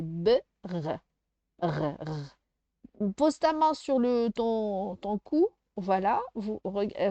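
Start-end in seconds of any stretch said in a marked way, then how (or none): 0.0-2.4 "B, r, r, r" as isolated letter sounds; separate sounds. Shown as a demonstration. Teacher helping a child hear the sounds, "B, r" pulled apart. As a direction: sound out B, then repeat a throaty French R. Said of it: "Ces deux sons là".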